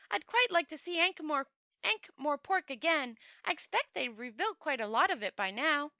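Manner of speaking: an eager, naive traveller happily agreeing; Two Flower cheerful. Acted.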